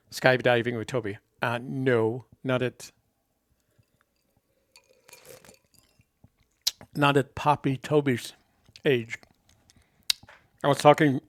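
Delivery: talking around ice in mouth; ice cubes clinking. Real, not acted. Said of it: "I can't talk with ice in my mouth".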